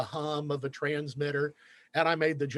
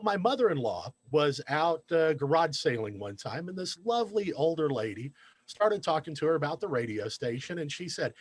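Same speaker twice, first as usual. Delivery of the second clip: ordinary talk, nothing marked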